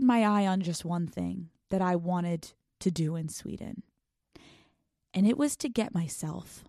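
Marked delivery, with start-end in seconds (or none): 3.2-3.8 whisper. Acted. To listeners, teasing her Sweden goal in a whisper. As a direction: drop to a breathy secret whisper.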